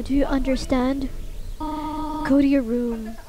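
slow, solemn guardian warning; weighty, slow lines with pauses between; Toriel. Acted. Toriel warns the child of leaving, slow and grave. Voices a game character's warning.

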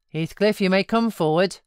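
mocking call across the room. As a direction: summon him with a sneer. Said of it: "cried Mister Hindley".

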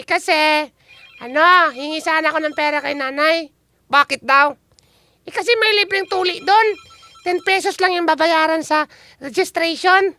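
high cartoonish kid voices; comic character voice. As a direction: banter like silly kids. Host voices kids in skit.

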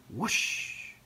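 long, airy whoosh; whooshing wind. A narrator's reading. Reader voicing the wind and rain, whooshing. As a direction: swoosh like a gust of wind.

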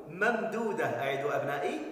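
usual speaking voice

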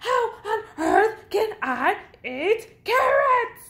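very high, loud wailing yell; exasperated shout. A narrator's reading. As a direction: shriek the question in outraged hunger. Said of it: "shouted Bird".

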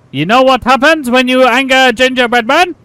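high, loud mock-furious threat; angry gingerbread man. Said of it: "Very, very angry".

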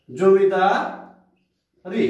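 drawn out demo word. Shown as a demonstration. Teacher stretching zamindari so students hear its ই ending. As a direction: stretch zamindari and let the ই linger. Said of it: "ই উচ্চারণ করছি".

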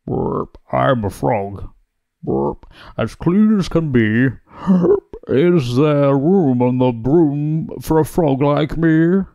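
burping frog voice, drawn out at the end; croaking frog. A narrator's reading. Frog politely asking the witch for room, croaking. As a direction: croak it like a frog punctuated by burps. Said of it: "then said with a croak".

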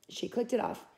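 plain reading voice

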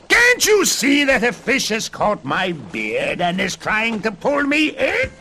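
very high, loud tantrum with drawn-out insults; piercing storybook dwarf fury; screeching rage. A narrator's reading. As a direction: screech insults like an outraged little man. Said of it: "screeched the little man".

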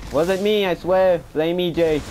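called-out denial. Real, not acted. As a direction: call across the house in self-defense. Calls out denial to parent.

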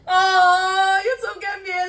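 high, loud, tearful cry; real joy spilling over; emotional outburst. Real, not acted. Creator reading YouTube's letter, high emotional cry. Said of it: "I'm emotional".

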